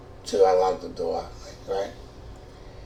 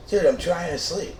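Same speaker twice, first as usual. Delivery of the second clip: annoyed roommate; 9 semitones higher, 5 dB louder than usual. Retold or imitated. Roommate complains about TV.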